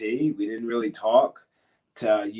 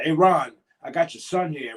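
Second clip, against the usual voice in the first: raised, higher call across a room; retold yell; 6 semitones higher, 6 dB louder than usual. Wrestler yells over to Ron in the locker room, retold loud call. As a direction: call out loudly to get someone's attention. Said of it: "he would yell over to Ron".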